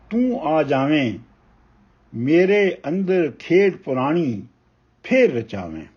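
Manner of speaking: measured, weighty reading of a Punjabi poem; ਕਵਿਤਾ ਪਾਠ ਦੀ ਠਹਿਰਾਵ ਵਾਲੀ ਅਵਾਜ਼; poem recitation. Acted. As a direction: recite each line slowly, letting pauses breathe. Poet recites poem Lukan Miti.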